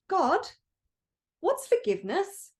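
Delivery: put-on high, eager childlike voice asking God; a small curious spirit looking up at God; bubbly little light asks God a question, high and curious. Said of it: "really bubbly and talkative".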